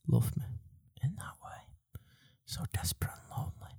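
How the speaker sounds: soft mock-lonely whisper. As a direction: breathe it out like a sad secret. Mock lonely confession to listeners.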